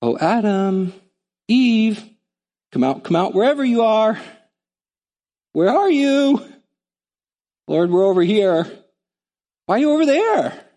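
high, calling voice of God seeking Adam; God playfully calling into the garden. Acted. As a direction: call out like a seeking father. Preacher voicing God calling Adam.

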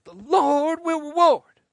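revival shout. Shown as a demonstration. Pastor tells viewers to shout it to family, loud. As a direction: proclaim it to your brother. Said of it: "And say it to him now".

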